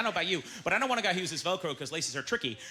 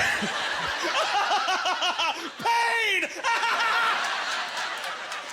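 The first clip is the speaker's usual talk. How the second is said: high, drawn-out mock villain cackle; villain laugh; 12 semitones higher, 4 dB louder than usual. Comedian mimicking someone laughing at grim news, villain laugh. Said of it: "a James Bond villain".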